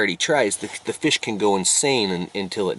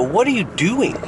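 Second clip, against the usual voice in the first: higher-pitched baffled question; 11 semitones higher than usual. Acted. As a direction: ask it like a puzzled passerby. Questioning a screaming digger in field.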